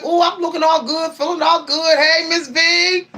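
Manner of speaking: playful bragging to the mirror; hyped-up self-boast. Retold or imitated. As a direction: hype yourself up, sing-song. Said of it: "I be like".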